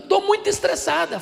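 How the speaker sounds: high, amused imitation of a little girl; girl's voice. Pastor voices stressed ten-year-old girl.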